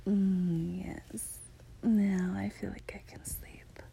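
slow sleepy whisper; soft drowsy goodnight whisper; pillow talk. Acted.

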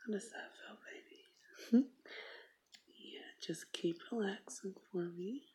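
breathy, teasing whisper close to the ear. Acted. Girlfriend teasing her shy partner, intimate whisper. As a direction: tease him softly in a whisper.

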